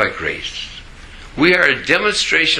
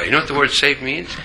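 ordinary talk, nothing marked

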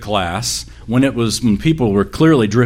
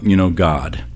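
ordinary talk

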